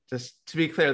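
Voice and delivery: ordinary talk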